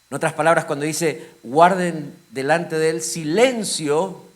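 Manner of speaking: loud, driving sermon delivery, slowing on key words; preaching at full force. Acted. Preacher explaining the verse to the congregation, loud and driving. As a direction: press the point home from the pulpit.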